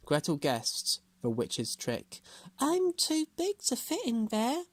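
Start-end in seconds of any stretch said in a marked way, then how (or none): 2.6-4.7 put-on high young girl voice. A narrator's reading. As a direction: play innocent as a girl. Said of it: "she said".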